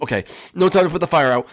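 ordinary talk, nothing marked

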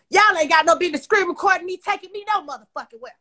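sudden loud blast of anger; angry shout. Real, not acted. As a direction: bark it out at them in real fury. Angry rant at people recording her.

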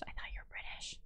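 unvoiced whisper, stress on you. Real, not acted. Aside to the stream viewers, whispered. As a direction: breathe it as an aside.

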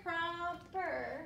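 "Proper" drawn out so its parts can be heard; "Proper" stretched to show where it divides; said slowly to hear the syllable split. Shown as a demonstration. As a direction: sound out "Proper" for a class. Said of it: "So when I'm saying proper".

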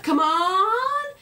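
loud, high call across a paddock; calling yell. Retold or imitated. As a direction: yell it to alpacas far off. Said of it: "you had to, like, yell it".

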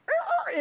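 high mock machine squeal; imitating an old washer squealing as it wrings.

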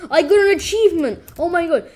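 loud, fast, high yell of delight; excited yell. Real, not acted. Gamer yells at a surprise achievement, loud and fast. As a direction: blurt it out thrilled.

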